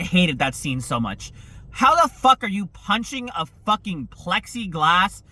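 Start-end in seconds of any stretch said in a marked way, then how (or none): none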